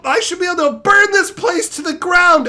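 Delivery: high-pitched indignant outburst; genuinely offended. Real, not acted. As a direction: protest like you've been personally insulted. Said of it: "I'm genuinely offended".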